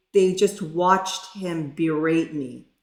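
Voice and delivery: normal talking voice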